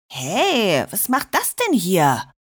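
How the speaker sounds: usual speaking voice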